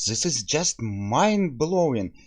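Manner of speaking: usual speaking voice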